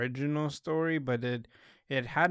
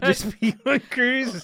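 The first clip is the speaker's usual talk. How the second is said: laughing loudly; 11 semitones higher, 9 dB louder, slower than usual. Real, not acted. Laughing suggestion.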